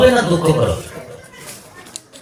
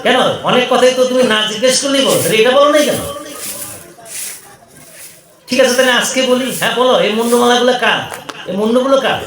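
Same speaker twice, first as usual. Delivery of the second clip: higher, insistent wifely questioning; Parvati demanding; 4 semitones higher than usual. Acted. Parvati questions Shiva about his skull garland, insistent and higher. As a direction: press your husband for the truth.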